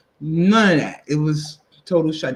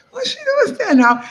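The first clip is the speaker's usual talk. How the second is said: much higher, fast, half-laughing; laughing child's question; faster than usual. Retold or imitated. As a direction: ask through laughter as a child. Child sees dead mother, laughing.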